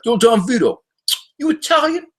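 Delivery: high, brash Philly Italian tough guy calling out; hammed-up street guy hailing a celebrity at a bar; South Philly dude. Philly dudes at a bar calling to Don Vito, high brash mock voice. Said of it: "they were like".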